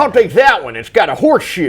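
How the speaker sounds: squeaky high folksy voice; Jimmy Stewart impression. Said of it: "That's not a cowboy voice at all".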